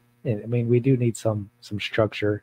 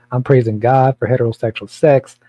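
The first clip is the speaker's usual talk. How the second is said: ordinary talk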